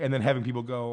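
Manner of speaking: normal talking voice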